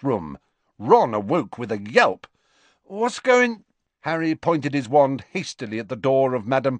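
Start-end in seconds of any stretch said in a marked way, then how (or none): 2.8-3.6 Ron jolted awake, higher and louder; startled Ron. Acted. Ron woken by the crack, startled and higher. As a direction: blurts it out half-asleep.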